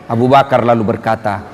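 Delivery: ordinary talk, nothing marked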